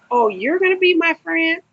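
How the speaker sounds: high, loud, sugary mock-friendly voice; mock sly predator. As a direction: lure the newcomer in sweetly. Mocking the predatory lifer.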